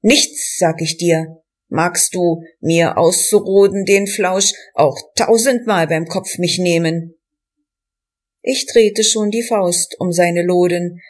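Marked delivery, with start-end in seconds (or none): none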